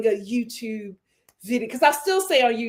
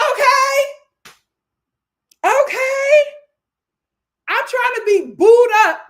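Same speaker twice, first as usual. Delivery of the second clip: loud and high, fired up; excited rant; 8 semitones higher, 8 dB louder than usual. Real, not acted. Streamer loudly tells chat her big plans, excited. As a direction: rant to them at full excitement.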